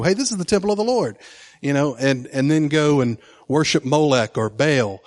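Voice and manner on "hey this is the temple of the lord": quick, higher-pitched glib parody; mocking quote. Preacher mimicking idolaters who claim the temple, high and fast. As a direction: toss it off like a careless slogan. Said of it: "They couldn't just kind of go".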